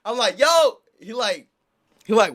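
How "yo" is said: calling out. Retold or imitated. Calling out to drunk guy.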